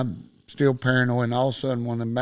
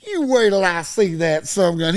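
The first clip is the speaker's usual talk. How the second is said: louder, higher retold outburst; indignant threat; 5 semitones higher, 5 dB louder than usual. Uncle hearing his buddy exaggerated, retold indignant threat. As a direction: promise payback, imitating an indignant uncle.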